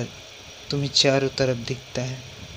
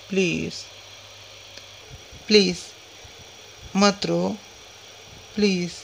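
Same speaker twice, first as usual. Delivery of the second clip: put-on pleading little girl; 2 semitones higher, 5 dB quieter than usual. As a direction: coax a crying brother like a small child. Child begs crying brother to stop.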